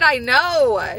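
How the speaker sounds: loud, high, fed-up retelling; snapping back at the acupuncturist, loud and high; exasperated retort. Retold or imitated. Retelling her reply to the acupuncturist, loud and exasperated.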